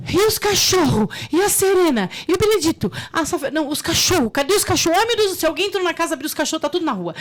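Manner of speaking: gritando em pânico, bem agudo e alto. Retold or imitated. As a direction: desespero histérico pelos cachorros. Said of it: "eu fico assim". (mãe em pânico gritando pelos cachorros perdidos)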